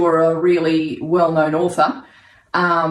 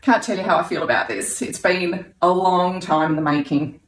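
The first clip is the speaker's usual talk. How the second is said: normal talking voice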